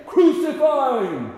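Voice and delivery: loud, drawn-out high cry that falls away; mob shout. Acted. His own people calling for crucifixion, shouting. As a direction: shout it slowly like a crowd demanding death. Preacher acts out crowd demanding death.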